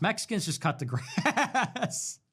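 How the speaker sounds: last word dissolves into a laugh; slowing into a real laugh; breaking into laughter. Real, not acted. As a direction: crack up as you finish the line. Cracks up at own joke.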